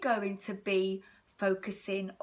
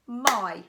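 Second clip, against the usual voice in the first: "My" said as one louder clapped beat; clapped syllable; 6 dB louder than usual. Shown as a demonstration. Teacher clapping "My" for the children, one beat. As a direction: give "My" a single clapped beat. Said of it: "Let's clap it together".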